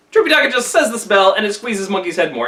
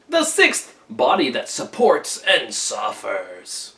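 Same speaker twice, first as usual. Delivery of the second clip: lurk and intone each sinister name slowly; menacing ruffians; 5 semitones lower, slower than usual. Acted. Ruffians introducing themselves to the monk in a low, slow menacing voice.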